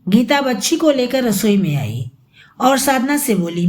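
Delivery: plain reading voice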